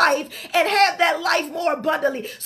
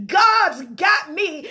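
ordinary talk, nothing marked

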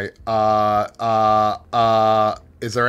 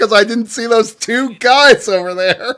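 high, loud exclamation; agitated realisation; 12 semitones higher, 10 dB louder than usual. Real, not acted. Player spots two guys missed earlier, agitated exclamation. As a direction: exclaim it loudly at high pitch. Realises his error in the game.

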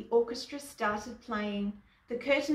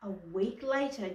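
usual speaking voice

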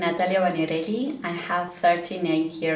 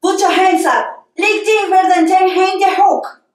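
high, forceful shout at a suspect; shouted gunpoint order; 10 semitones higher, 8 dB louder than usual. Acted. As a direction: shout it as an urgent armed command. Acted gunpoint order to surrender.

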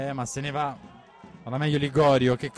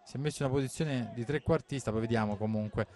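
usual speaking voice

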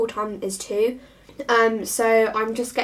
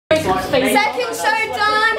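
excited; 11 semitones higher, 5 dB louder, much slower than usual. Real, not acted. Excited backstage after the show.

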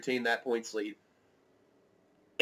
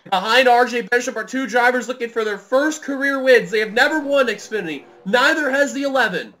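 raised, eager race call; live play-by-play; 9 semitones higher, 7 dB louder than usual. Real, not acted. Commentator setting up the final lap, keyed-up play-by-play. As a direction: keep the tension up over the field.

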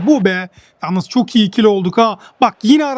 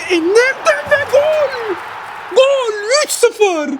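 far higher, slow, triumphant cry; goal celebration; 11 semitones higher, slower than usual. Real, not acted. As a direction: shout it out like the crowd is roaring. Scoring the third goal.

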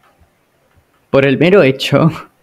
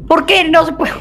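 frustrated outburst; 13 semitones higher than usual. Real, not acted. Frustrated at failing jump.

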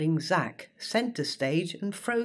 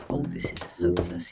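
hushed inner voice, lower; thought aloud; 10 semitones lower, faster than usual. Acted. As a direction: mutter it to yourself. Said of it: "he thought".

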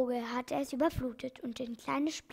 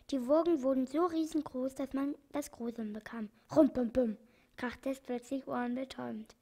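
ordinary delivery

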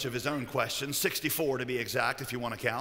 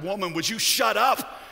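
louder, higher outburst at the end; exasperated; 4 semitones higher, 5 dB louder than usual. Acted. Husband to his tattling wife, exasperated. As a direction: snap in acted exasperation. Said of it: "you know, exasperated".

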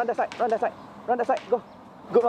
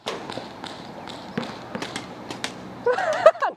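high breathy laugh. Real, not acted. Teammate's good shot.

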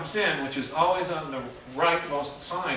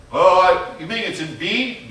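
higher, louder puzzled pianist; mock realization; 5 semitones higher, 9 dB louder than usual. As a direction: act out a confused musician's hopeful guess. Voicing the pianist's guess.

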